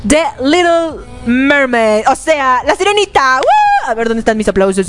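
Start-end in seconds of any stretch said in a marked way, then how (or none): 3.4-3.9 high excited whoop. Real, not acted. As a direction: cheer it out high. Celebrating the news.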